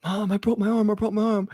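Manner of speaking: put-on high kid's voice, pleading; son's complaint. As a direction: whine like a hurt little boy. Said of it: "he's like".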